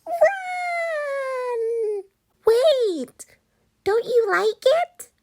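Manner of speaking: high panicked yell; panicked shout. Acted. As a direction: scream it in terror. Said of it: "the witch shouted".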